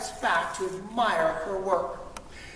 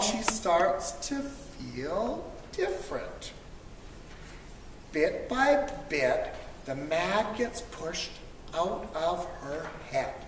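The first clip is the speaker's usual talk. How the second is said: calming down; 4 semitones lower, 8 dB quieter than usual. A narrator's reading. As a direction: let the anger drain away gently. Calm returns after a walk.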